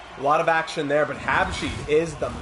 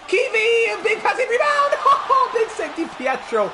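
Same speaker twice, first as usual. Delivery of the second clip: much higher and louder, breaking into a laugh; shrieking excitement; 8 dB louder than usual. Real, not acted. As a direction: yelp at the save, then laugh it out. Huge save on rebound.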